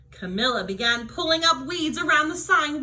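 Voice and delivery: ordinary delivery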